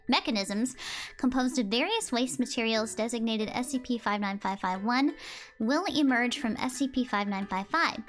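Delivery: normal talking voice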